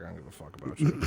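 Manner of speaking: deep, soft, dismissive growl; mock low Buu. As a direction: brush him off like a bored monster. Buu voiced in a retelling.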